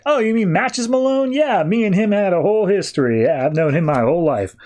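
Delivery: light up at the name; eager surprise. Retold or imitated. Rock eagerly recalls Matches Malone.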